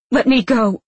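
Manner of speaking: loud, high demand; angry demand. A narrator's reading. Woman struggling against his hold, angry demand.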